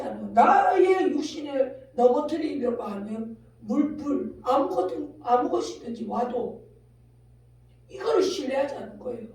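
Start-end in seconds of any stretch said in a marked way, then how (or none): none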